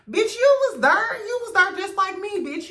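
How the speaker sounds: high, loud call-out at Stormy; outraged yelling. Real, not acted. Vlogger yelling at a reality star, high and loud. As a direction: shout it at her, fed up. Ranting at Stormy, calling her out.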